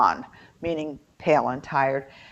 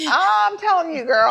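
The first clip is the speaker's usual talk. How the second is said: higher and louder, eager; excited; 11 semitones higher, 6 dB louder than usual. Real, not acted. Backing up her friend's excitement, higher and louder. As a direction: jump in eagerly, pitched up. Excited reply about the moonshine.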